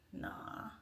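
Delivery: breathy dismissive nah; whispered nah. Real, not acted. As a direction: wave it off in a breath. Rejecting a greeting.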